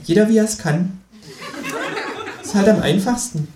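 cocky know-it-all kangaroo; Känguru voice. Acted. Känguru figure voice. (selbstgefälliges Känguru, höher gesprochen)

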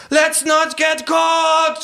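high, slow drawn-out shout; kangaroo shouting. Acted. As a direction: scream it while flooring the gas. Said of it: "schreit das Känguru". (sehr hoher, langgezogener Schrei)

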